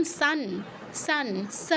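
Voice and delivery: "সান" as English sun, "স" as sa. Shown as a demonstration. Teacher likening it to English, "সান" and "স" with s. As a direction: start "সান" and "স" with a clean s, not sh. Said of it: "অনেকটা ইংরেজি এস এর উচ্চারণ".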